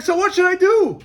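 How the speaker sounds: high, loud pleading question; desperate plea. Retold or imitated. Peddler begs the wise man for advice, high plea. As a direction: beg for an answer.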